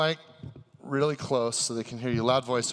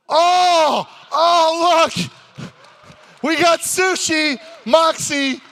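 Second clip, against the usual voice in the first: excited shout; 13 semitones higher, 11 dB louder, much slower than usual. Acted. Host reveals the surprise sushi.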